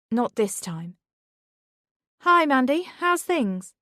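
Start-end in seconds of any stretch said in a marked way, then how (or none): 2.2-3.7 called out loud and high across the playground; forced cheery shout across the yard. Acted. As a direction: shout a bright greeting at a group. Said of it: "she shouted towards one group".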